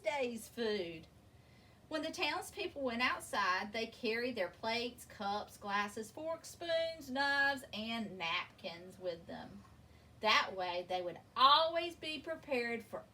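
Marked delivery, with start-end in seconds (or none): none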